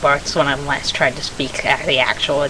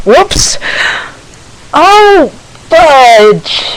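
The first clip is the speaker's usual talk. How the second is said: much higher and louder startled yelp over a small mishap; startled oops; 11 semitones higher, 9 dB louder, much slower than usual. Real, not acted. Vlogger caught off guard mid-video, startled and loud. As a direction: blurt it out as if something just fell.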